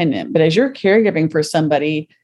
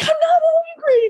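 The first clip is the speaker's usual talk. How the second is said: high, cracking shouted denial; crying shout. Retold or imitated. Insisting to her friend she isn't angry, a crying shout. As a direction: yell it through tears, much higher.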